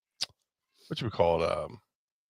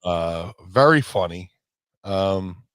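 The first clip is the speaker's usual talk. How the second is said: normal talking voice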